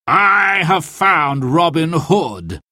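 slow, gloating mock cry; triumphant cry. Sir Guy unmasks himself to Robin, gloating slowly. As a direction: draw it out like a villain unmasking himself. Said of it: "he cried".

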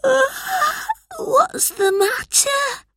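put-on high, slow yawning child; sleepy, drawn-out little-boy voice; waking yawn. A narrator's reading. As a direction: yawn through the words, just woken. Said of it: "slowly opened his eyes and yawned".

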